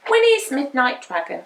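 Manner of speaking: plain reading voice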